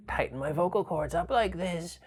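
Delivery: high, slow, reedy and trembling; shaky old person voice. Shown as a demonstration. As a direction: shake the voice like a frail elder. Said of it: "reedy sort of shaky voice that might be for an old person".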